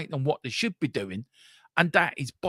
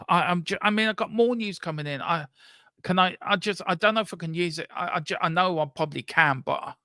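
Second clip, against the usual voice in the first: usual speaking voice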